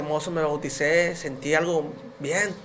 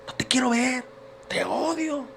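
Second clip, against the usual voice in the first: higher voice, a hint of the wife's anger; mock angry wife; 8 semitones higher than usual. Wife turning her husband away at midnight, lightly acted anger. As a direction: voice her telling him to go away. Wife rejecting him, retold.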